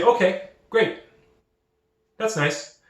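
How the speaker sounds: breezy recruiter. Retold or imitated. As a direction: play the pleased recruiter who just got a cheap hire. Imitating a recruiter lowballing a candidate.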